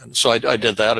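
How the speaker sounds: ordinary talk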